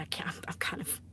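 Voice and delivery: soft, half-voiced mumble; embarrassed whisper. Real, not acted. Streamer whispering to viewers after being outed. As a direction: trail off in embarrassment.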